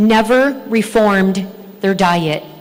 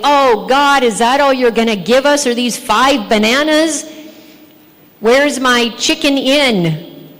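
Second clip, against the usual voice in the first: mock complaining; 3 semitones higher than usual. Preacher pretending to protest five bananas to God, mock complaining. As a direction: whine like an ungrateful diner.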